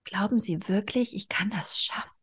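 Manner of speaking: soft, unvoiced trembling whisper. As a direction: barely dare to ask it. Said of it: "fragte sie mit bebender Stimme".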